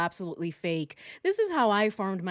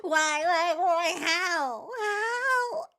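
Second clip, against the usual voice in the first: high-pitched fake anguished wail; mock crying; 9 semitones higher, much slower than usual. Commentator parodies her reaction to the crime, fake crying. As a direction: imitate a weepy outburst, climbing higher. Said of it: "She's like".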